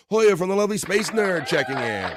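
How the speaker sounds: loud, high, hyped-up shout-out; excited shout-out. Real, not acted. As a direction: announce him like a big arrival. Hyped shout-out to Space Nerd.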